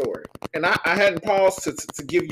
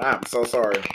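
usual speaking voice

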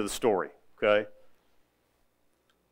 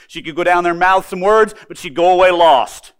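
loud, high ringing warning; fervent preaching; 6 semitones higher, 11 dB louder than usual. Real, not acted. Preacher warning the congregation, loud and ringing. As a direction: proclaim it to the back row. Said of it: "emphatic preaching point".

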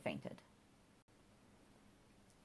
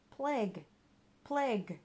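raised, higher alarmed cry; loud cry; 6 semitones higher, 3 dB louder than usual. A narrator's reading. Mister Giggles warning Johnny off the bin, crying out loudly. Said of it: "cried Mister Giggles, loudly".

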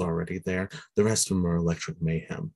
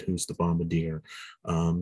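usual speaking voice

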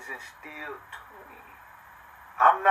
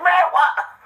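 high, loud mock disbelief; imitated incredulous reaction; 7 dB louder than usual. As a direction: put on a high-pitched, incredulous listener. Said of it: "Motherfuckers be like".

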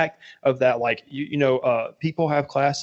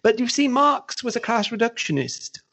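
high-pitched, hammed-up British accent; mock a posh-sounding Brit with a fake accent, pitched high; 7 semitones higher than usual. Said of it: "with his fake ass British accent".

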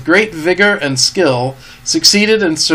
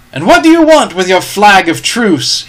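raised, challenging call; captain's cry; 5 semitones higher, 6 dB louder than usual. A narrator's reading. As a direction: demand it loudly across the yard. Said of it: "he cried".

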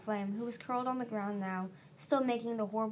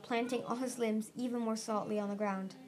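ordinary delivery

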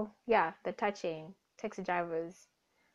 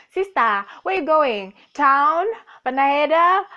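loud calling out to a passerby, higher; 4 semitones higher, 11 dB louder than usual. Retold or imitated. Taxi driver shouting for fares at a student, loud call. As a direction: hail her loudly like a taxi driver touting for fares.

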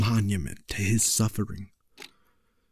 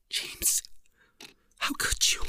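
whisper; 6 dB quieter than usual. A narrator's reading. Mother appalled, hand over mouth.

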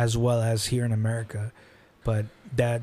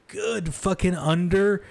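a little tipsy, groping for words; 6 semitones higher, slower than usual. Real, not acted. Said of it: "I'm a little tipsy here".

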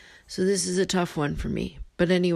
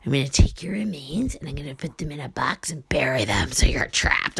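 creepy whisper; 3 semitones lower than usual. Retold or imitated. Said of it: "He's like".

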